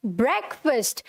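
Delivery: ब्रेकफास्ट as "BREK-fust"; short e and reduced second vowel in ब्रेकफास्ट; correct pronunciation. Shown as a demonstration. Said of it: "आज से ये pronunciation है".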